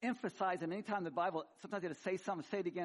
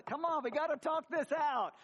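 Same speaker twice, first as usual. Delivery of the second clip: pleading husband at door; 11 semitones higher, 7 dB louder than usual. Retold or imitated. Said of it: "I just beat on the".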